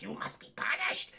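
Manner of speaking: whispered villain threat; villain whispering to a captured kid; menacing whisper. Retold or imitated. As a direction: breathe it out like a threat. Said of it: "And he's like".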